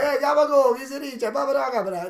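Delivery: high, gibberish Italian-American caricature; mock Italian-American accent. Co-host mocking fake Italians, babbling Italian-American accent. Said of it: "far from real Italians".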